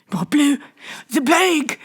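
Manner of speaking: high, startled cry of dread; fearful cry. A narrator's reading. Crowd shrinks from the plague cart, fearful. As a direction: recoil in horror.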